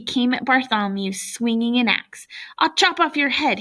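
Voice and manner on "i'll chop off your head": higher-pitched mock sneer; sneering threat. A narrator's reading. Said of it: "he sneered".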